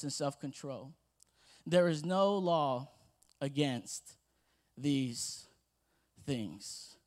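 slow, softening scripture reading. Acted. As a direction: let each fruit land gently. Reads Galatians scripture aloud.

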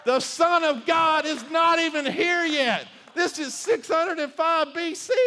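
high, loud preacher's cry; fiery sermon peak, loud and high; full-force preaching. Acted. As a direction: drive it home to the congregation.

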